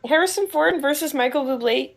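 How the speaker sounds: ordinary delivery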